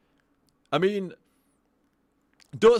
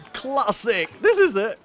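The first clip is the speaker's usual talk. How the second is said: delighted; 7 semitones higher, 7 dB louder than usual. Real, not acted. Delighted by chat quote.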